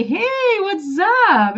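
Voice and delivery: high, lively "hey" greeting; casual greeting. Acted. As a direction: greet someone as they walk up. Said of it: "when someone comes to you".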